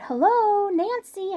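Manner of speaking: loud, high call across the yard. Retold or imitated. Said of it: "I said it louder".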